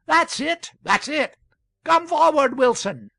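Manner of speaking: high, loud cries of approval; crowd calling out. A narrator's reading. Townspeople urging Wilson to the platform, loud calling out. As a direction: call out eagerly from the back of the hall.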